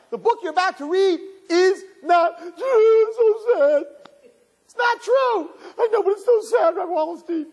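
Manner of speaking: student wailing to the rabbi that it's so sad; whiny protesting student. Acted. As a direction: whine it to the rabbi. Student whining back to rabbi.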